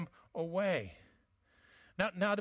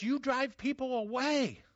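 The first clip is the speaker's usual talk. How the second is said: stern rebuke; 6 semitones higher than usual. Retold or imitated. Preacher quoting Jesus' rebuke.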